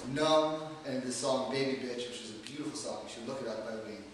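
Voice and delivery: ordinary talk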